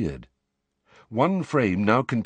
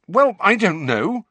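put-on raised, angry young voice; 12 semitones higher, 7 dB louder than usual. A narrator's reading. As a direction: snap it back, irritated. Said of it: "said Harry rather angrily".

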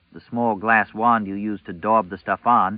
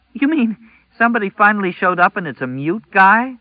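very high, loud, whiny disbelief; exasperated girl voice; frustrated teen girl; 11 semitones higher, 7 dB louder than usual. A narrator's reading. Girl after frustrated laugh.

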